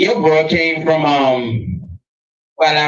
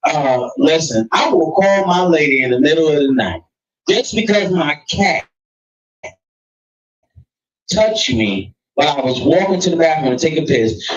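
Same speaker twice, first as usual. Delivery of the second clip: ordinary delivery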